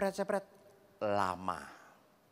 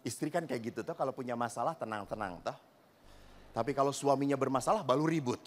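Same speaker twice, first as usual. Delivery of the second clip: ordinary talk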